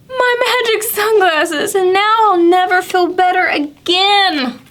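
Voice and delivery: read-aloud cat character, mildly upset; Pete sad. Acted. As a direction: lament the lost sunglasses in Pete's high voice. Pete voiced, sad about glasses.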